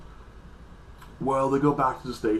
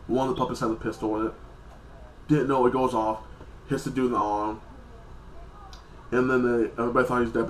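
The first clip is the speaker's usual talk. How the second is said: normal talking voice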